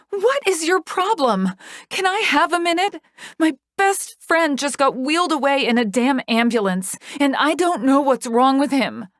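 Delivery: high, loud furious protest; angry yell. A narrator's reading. Yelling at Joker who shoves her out, furious. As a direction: rage at the man shoving you.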